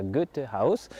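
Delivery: slow, drawn-out German attempt at Goethe Haus; French speaker's careful German accent; poor German accent. Shown as a demonstration. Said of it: "avec mon accent allemand, euh, pas terrible".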